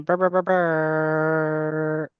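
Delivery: long, rolled brr ending on a held note; drawn-out brr fanfare; imitated horn noise. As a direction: trill out a mock celebration horn. Imitates fanfare for third win.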